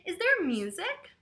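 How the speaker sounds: put-on bright curious child question; a child asking eagerly about music; excited child voice. A narrator's reading. Noah excitedly asking if there's music.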